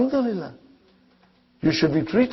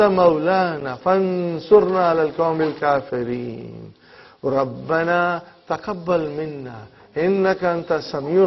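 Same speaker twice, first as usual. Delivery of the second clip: soft, even-toned Arabic supplication; prayer recitation; 6 dB quieter, much faster than usual. Real, not acted. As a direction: recite a closing dua quietly, drawing out the long vowels. Closing Arabic supplication after the lesson.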